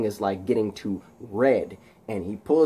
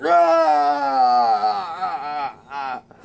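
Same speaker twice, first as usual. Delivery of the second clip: agonised electrocution scream; 2 semitones higher, much slower than usual. Acted. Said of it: "and we hear".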